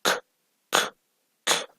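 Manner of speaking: "k" said alone with no vowel; bare k sound. Shown as a demonstration. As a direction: model the bare k three times. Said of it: "pues va a sonar". ("k" sola, sin vocal)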